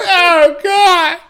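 high, crying put-on protest; mock distressed wail. Acting out finding the mess on the face, mock wailing. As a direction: wail it in hammed-up disgust. Acts out disgust at cat.

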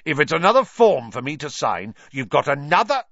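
Vernon. A narrator's reading. Vernon threatening Harry.

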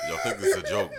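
high, loud laugh-cry wail; hammed-up tearful plea; mock crying. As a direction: fake a sob through laughter.

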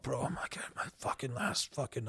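secretive late-night confession, barely voiced; whispering to a friend on the phone at one in the morning; hushed whisper. Retold late-night call, desperate.

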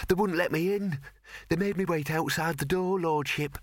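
high, anxious servant voice; nervous servant. Acted. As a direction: fidget through it, eager to please. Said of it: "twisted his fingers together nervously".

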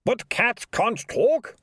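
high, slow, puzzled mayor; a bewildered town mayor asking. A narrator's reading. Mayor voiced in read story.

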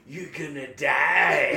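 high, drawn-out menacing shout; Maris the Great. Retold or imitated. Character answers a fan's thanks with a threat, roaring shout. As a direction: belt out a death threat, stretching the last word. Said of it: "He's like".

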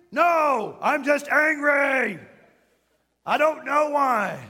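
loud, high Hulk-style outburst; exaggerated angry-man shouting; mock uncontrolled anger. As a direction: roar it out in hammed-up rage. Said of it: "uncontrolled Hulk anger".

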